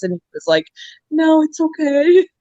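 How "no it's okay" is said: high, mildly pitiful imitation; a touch of mock sadness in a high voice; retold upset son. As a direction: imitate a son feeling horrible, pitched up. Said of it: "he felt horrible".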